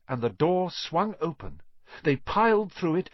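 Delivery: plain reading voice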